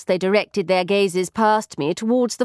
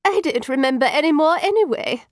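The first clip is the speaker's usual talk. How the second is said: higher-pitched, huffy comeback; retort; 7 semitones higher than usual. A narrator's reading. Taffeta answering her companion's order, retorting. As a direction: toss back a retort. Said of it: "retorted Marion Taffeta".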